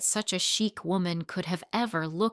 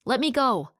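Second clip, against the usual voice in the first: higher, louder cry to be let go; lifted off the ground, louder pleading protest; struggling plea; 5 semitones higher, 5 dB louder than usual. Acted. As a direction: pull free and demand it. Old man lifted, struggling.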